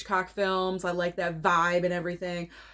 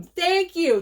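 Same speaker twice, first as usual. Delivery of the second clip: excited; 9 semitones higher, 5 dB louder than usual. Real, not acted. Thanking subscriber.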